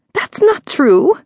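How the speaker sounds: high, drawn-out shrill denial. A narrator's reading. Miss Clarveau denying the caller's claim, shrill. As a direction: protest shrilly, pitch leaping up. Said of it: "Miss Clarveau said shrilly".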